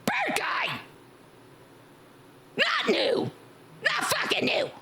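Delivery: high maniac scream; screamy voice. Shown as a demonstration. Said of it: "I just scream like a maniac".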